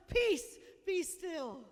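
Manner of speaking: slow and steady, each word weighed; calm command. Acted. Jesus commanding the storm, slow calm command. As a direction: still the storm with calm authority. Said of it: "stretches his arms out into the storm and says".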